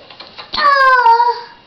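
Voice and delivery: high loud theatrical gasp; big mock gasp. Parent gasping in pretend surprise at the page.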